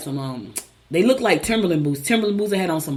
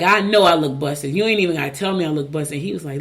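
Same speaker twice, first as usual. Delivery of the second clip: ordinary talk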